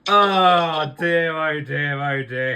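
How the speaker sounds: heavy theatrical sigh, fading; dramatic sigh. Acted. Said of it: "Should we take a big sigh?".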